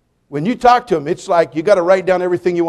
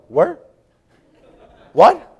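much higher, louder baffled What; baffled sailors; 9 semitones higher, 5 dB louder than usual. Retold or imitated. Preacher imitating confused sailors in Bible study, much higher. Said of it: "men down there going".

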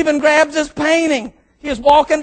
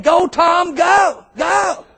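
cheering him on; 6 semitones higher, much slower than usual. Retold or imitated. Cheering Kincaid on while videoing.

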